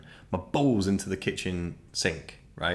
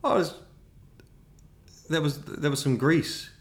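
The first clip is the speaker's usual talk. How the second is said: flustered lie; 6 semitones higher than usual. Retold or imitated. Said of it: "And I was like".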